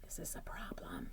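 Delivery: hushed dramatic whisper. Acted. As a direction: whisper it like a secret to the kids. Dramatic aside to children.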